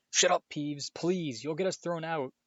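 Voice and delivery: urgent plea. A narrator's reading. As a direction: beg a troublemaker to stop. Pleading with noisy Peeves to hush.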